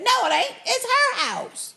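high mock indignation; mock indignant retort. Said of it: "He said".

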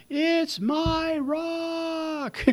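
very high, very slow, drawn-out whine; whiny protest. Retold or imitated. Drowning man protesting again, high childish whine. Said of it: "he protests".

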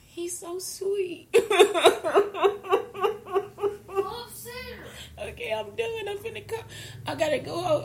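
gushing through happy tears; laughing and crying. Real, not acted. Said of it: "I'ma laugh and cry".

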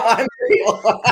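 high, loud and laughing; laughing I'm real. Retold or imitated. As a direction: giggle it out in delight. Repeated, laughing.

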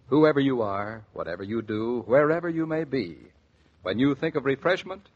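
ordinary talk, nothing marked

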